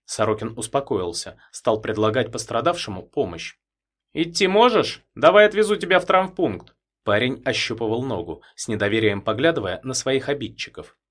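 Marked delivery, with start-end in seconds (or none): 4.1-6.8 captain's voice. A narrator's reading. Sorokin offers help.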